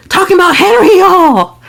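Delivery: high, loud incredulous exclamation; loud disbelief. Real, not acted. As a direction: cry it out to the viewers in disbelief.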